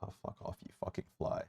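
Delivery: hushed, breathy swearing under breath; swearing tirade. Real, not acted. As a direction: mutter curses at the fly. Swatting at a fly.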